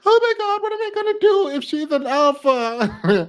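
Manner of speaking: high, whiny mock panic; mock panicked man. Mocking men who fear alpha women, high and whiny. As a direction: squeal it like a frightened boyfriend. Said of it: "They're like".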